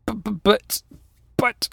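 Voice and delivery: high, stammering disbelief; halting, flustered high voice; muttering paper tiger. Acted. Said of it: "muttered the paper tiger".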